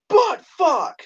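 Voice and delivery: very high mimicked outburst; Tourette's guy. Tourette's guy beside a stranger at the interview, high mock outburst. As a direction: blurt it in a put-on high voice. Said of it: "Tourette's guy goes".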